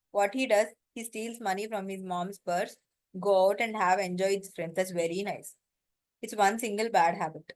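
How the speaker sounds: ordinary talk, nothing marked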